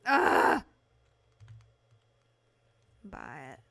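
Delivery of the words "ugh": short, loud, high "ugh"; sudden frustrated groan. Real, not acted. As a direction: let out an exasperated grunt. Frustrated noise while playing.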